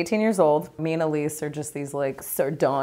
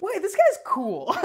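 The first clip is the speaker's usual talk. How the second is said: high-pitched dawning realisation; retold amazed reassessment; surprised approval; 13 semitones higher than usual. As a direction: squeak it as you change your mind. Acting the girls' surprised approval.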